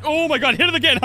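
frantic. Real, not acted. Frantic at the game controls.

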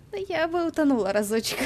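high, bright playful quip; playful excited. Real, not acted. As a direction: toss it off with a giggle. Joking reply, high and loud. (игриво и возбуждённо)

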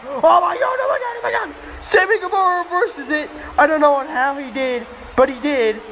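high-pitched frantic play-by-play; shouted disbelief, easing slightly toward the end; excited commentary shout. Pretend. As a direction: lose it at a stunning reversal. Commentator reacts to a reversal.

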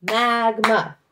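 Mag-ma, loud, two even beats; two loud clapped beats; syllables clapped out. Shown as a demonstration. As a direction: clap each syllable for the class. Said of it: "let's clap magma and stomp magma".

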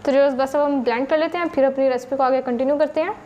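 ordinary talk, nothing marked

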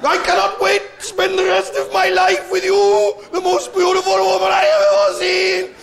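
high, loud, slow Welsh wail, pained even in joy; mock Welsh groom. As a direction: do a Welsh groom sounding pissed off at his own wedding. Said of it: "he sounds pissed off".

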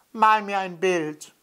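put-on high, stubborn child's demand; insistent child. A narrator's reading. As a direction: repeat it like a nagging little boy. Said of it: "der kleine blonde Junge". (hohe, quengelnde Kinderstimme)

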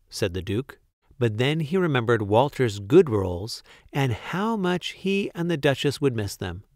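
plain reading voice

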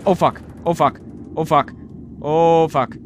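loud alarmed curses; panicking as the sub hits something; panicked cursing. Real, not acted. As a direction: swear as everything goes wrong. Crash panic.

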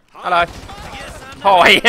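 loud, cheeky "Hi"; a loud, taunting hello to the unaware guards; mock loud greeting. Real, not acted. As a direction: bellow a fake-friendly hello at them. Greeting unaware guards before the riot.

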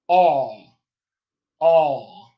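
"all, all." as a bare rounded "aw" vowel, loud; open back rounded. Shown as a demonstration. Coach demonstrating the rounded vowel, loud "all, all.". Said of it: "open back rounded vowel".

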